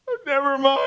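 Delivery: imitated crying. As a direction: imitate a man starting to cry on the phone. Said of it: "he started crying on the phone".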